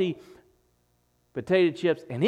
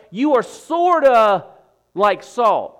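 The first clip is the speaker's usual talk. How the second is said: higher and louder, slow, 'sorta' drawn out; mock hedging; 5 semitones higher, 8 dB louder, slower than usual. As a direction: play the vague wrong version. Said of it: "It's not what he's saying".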